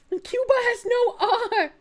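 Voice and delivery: cracking up mid-sentence; laughing. Real, not acted. Laughing at his accent.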